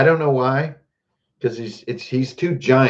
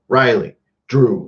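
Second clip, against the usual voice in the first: normal talking voice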